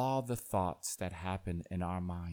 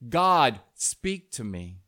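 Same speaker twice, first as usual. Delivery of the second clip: yelled plea; 2 semitones higher, 11 dB louder than usual. A narrator's reading. Man in parable calls on God, yelling. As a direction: yell out God, then ease off. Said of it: "So the man yelled".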